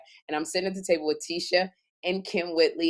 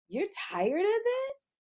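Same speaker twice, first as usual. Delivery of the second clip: much higher, softer, slow and confused; clueless echo rising into what; dumb blonde; 12 semitones higher, 8 dB quieter, slower than usual. Retold or imitated. Retelling her dumb blonde echo of Kim, high soft confused. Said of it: "And I would go".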